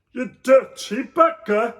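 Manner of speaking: loud, slow, high scolding; indignant Joseph. A narrator's reading. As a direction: tell the donkey off. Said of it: "said Joseph".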